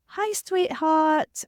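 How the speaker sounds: high, slow, sing-song sweetheart greeting; gentle greeter. Retold or imitated. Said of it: "the guy was like".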